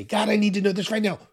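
loud, high rushed plea to God; mock-urgent prayer, pitched high and loud; urgent demand. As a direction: blurt an impatient prayer at full volume. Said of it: "We just say".

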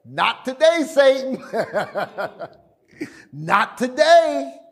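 high, teasing dismissal with a laugh; amused, lightly acted refusal; Jesus's rebuff. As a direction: brush the threat off playfully, stretching the repeat. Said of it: "Jesus said to him".

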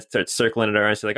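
ordinary talk

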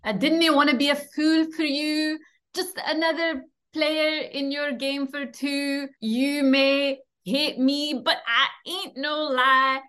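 lightly put-on Scottish accent, slipping into song; attempted Scottish accent. Guest improvising the monologue in a Scottish accent. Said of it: "read the monologue in a Scottish accent".

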